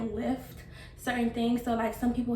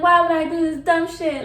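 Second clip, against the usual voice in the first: louder, higher, fed-up cry; exasperated outburst; 5 semitones higher, 9 dB louder than usual. Retold or imitated. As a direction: complain loudly to yourself after surgery. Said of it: "I was like".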